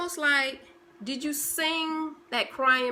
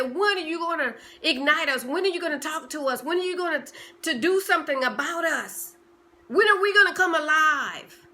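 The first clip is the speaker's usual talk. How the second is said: louder, higher urgent plea; pleading dreams; 6 semitones higher, 6 dB louder than usual. Pretend. Your dreams calling out to you, louder and pleading. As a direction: beg to be woken up, rising to a cry.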